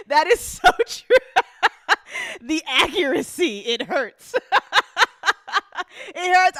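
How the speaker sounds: high and loud, squeezed out through laughter; laughing. Real, not acted. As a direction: barely get it out for laughing. Cracks up at a joke.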